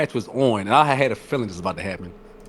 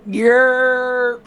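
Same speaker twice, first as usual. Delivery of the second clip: high hype call; 13 semitones higher than usual. Acted. Catchphrase in intro.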